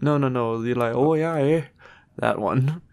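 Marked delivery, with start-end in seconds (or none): 1.0-1.6 higher-pitched put-on Newfie accent; mock Newfie accent. Correcting co-host's attempt, mock Newfie accent. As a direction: do a caricature Newfie Canadian. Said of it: "Like a Newfie accent".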